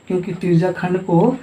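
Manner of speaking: ordinary talk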